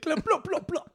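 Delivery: high plop noises; playful high plop sounds; mock plopping. Answering friend's clicks, playful high plops. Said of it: "Click, click".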